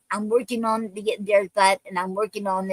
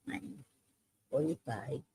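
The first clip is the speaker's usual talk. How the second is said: murmured to herself; 7 semitones lower, 15 dB quieter than usual. Real, not acted. Repeating the end time to herself.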